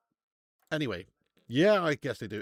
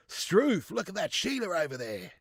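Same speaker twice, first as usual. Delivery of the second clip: put-on high, exclaiming Australian accent; hammed-up Aussie astonishment, starting high; Aussie impression; 4 semitones higher than usual. Streamer spotting a Sheila, exaggerated Australian accent. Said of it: "Do an Aussie impression?".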